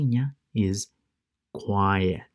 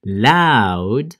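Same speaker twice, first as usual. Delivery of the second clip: loud, drawn-out teaching word; loud demonstration; 7 dB louder than usual. Shown as a demonstration. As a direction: say it big and loud.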